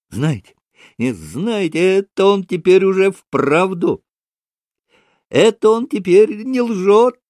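high, eager, climbing in pitch; excited exclamation. A narrator's reading. Kalganov turns to Mitya to vouch for the tale, excited. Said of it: "восклицал, обращаясь к Мите".

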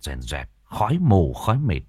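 plain reading voice

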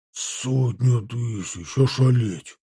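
slow, drawn-out amazement; stunned soldier dragging the words out; astonished exclamation. A narrator's reading. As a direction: marvel at the huge number. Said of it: "воскликнул не удержавшийся унтер-офицер".

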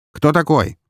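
high, brusque police question; sharp questioning. A narrator's reading. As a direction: demand an answer sharply. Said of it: "резко повторил он вопрос".

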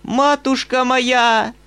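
high, loud, slow shriek; old mistress shrieking at the governess, slow and loud; shrieking old woman. A narrator's reading. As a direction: shriek it on her knees. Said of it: "завизжала она".

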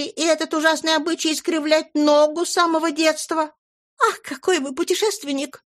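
high, fluttery chatter; gushing lady. Acted. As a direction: gush like a flustered old flame. Flora's gushing speech.

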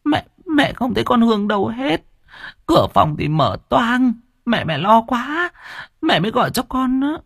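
mother explaining to her son, distraught. Acted. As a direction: plead in distress.

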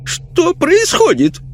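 high, shocked lady's voice; startled lady exclaiming. A narrator's reading. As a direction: wake up confused and alarmed. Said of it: "воскликнула она".

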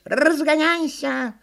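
very high, drawn-out squeal; stretched monkey command; excited monkey order. A narrator's reading. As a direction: urge them on as a squeaky monkey.

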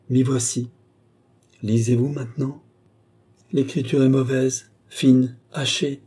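ordinary delivery, nothing marked

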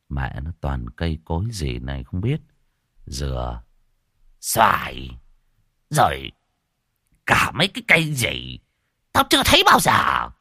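trầm khẽ rồi bật to cao; lão già lầm bầm rồi la; càu nhàu rồi quát. Acted. (lão già càu nhàu)

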